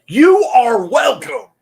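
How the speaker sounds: high, loud, drawn-out showman cry; mock grand shout. Streamer hamming it up for chat. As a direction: belt it like a game show host.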